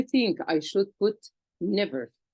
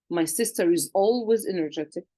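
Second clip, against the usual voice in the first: ordinary talk, nothing marked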